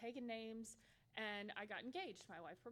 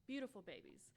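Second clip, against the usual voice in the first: ordinary talk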